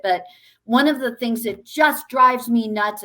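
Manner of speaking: ordinary talk, nothing marked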